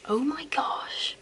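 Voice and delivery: a slow breathy whisper on Oh my gosh. Acted. Whispering to her friend about the old perfume, slow. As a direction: drag the whisper out, slow and hushed.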